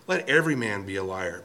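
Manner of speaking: normal talking voice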